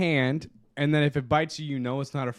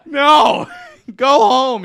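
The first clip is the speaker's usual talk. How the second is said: louder, higher, slow incredulous yell at a cook; angry manager yell; 10 semitones higher, 14 dB louder, much slower than usual. Retold or imitated. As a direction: boss bellowing at a bleeding employee. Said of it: "And the manager was like".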